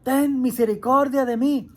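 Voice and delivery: demonstrated cry for mercy, rising in volume; shouted plea. Shown as a demonstration. Bartimeo cries to Jesus passing by, shouting. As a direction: call out loudly to be heard, building. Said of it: "así le gritó este hombre".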